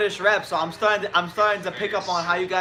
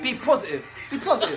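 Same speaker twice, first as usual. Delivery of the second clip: higher-pitched British boxer urging positivity; O'Hara Davis imitation; 5 semitones higher than usual. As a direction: lightly imitate a British fighter's pep talk. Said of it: "this is my O'Hara Davis imitation".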